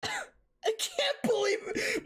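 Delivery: high, slow wailing whine; a hammed-up cry of despair; drawn-out anguished squeal; mock disbelief. Real, not acted.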